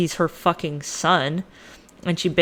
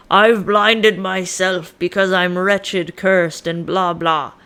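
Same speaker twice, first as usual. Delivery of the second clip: slow, loud, hammed-up lament; mock tragic proclamation; 5 dB louder, slower than usual. Blinded Oedipus addresses the people, mock tragic. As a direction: declaim it slowly like an overblown tragic hero.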